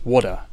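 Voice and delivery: "water" with a flapped T and no final R; "water" the Australian way, D-like T, R silent. Shown as a demonstration. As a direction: say "water" like "waw-duh". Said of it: "with a flapped T and no R".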